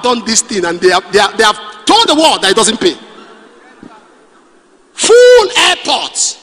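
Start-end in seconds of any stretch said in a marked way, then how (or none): 4.9-6.4 slow, high Full airport; a drawn-out, astonished Full airport; amazed exclamation. Real, not acted. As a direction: stretch Full airport in disbelief. Amazed preacher repeats.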